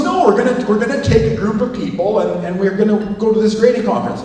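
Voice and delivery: hammed-up colleague insisting; mock pushy colleague. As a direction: push the plan on him, keen. Colleague voiced insisting on trip.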